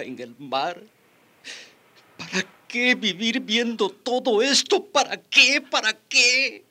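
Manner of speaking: very high, crying wail; anguished despair. Acted. Survivor asks why go on living, sobbing despair. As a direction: wail it through tears. Survivor sees no reason to live.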